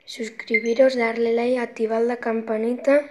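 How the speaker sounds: loud, energetic YouTuber sign-off; loud outro. Acted. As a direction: call out to the viewers with energy.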